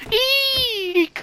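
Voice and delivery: very high, loud put-on squeal; a sudden frightened squeak; high shriek. Frightened by ghost.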